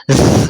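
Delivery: ز as a bare buzzing z; isolated sibilant ز sound; sharp whistle. Shown as a demonstration. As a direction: hold ز as a whistling hiss with voice. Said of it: "سیٹی کی تیز آواز نکل رہی".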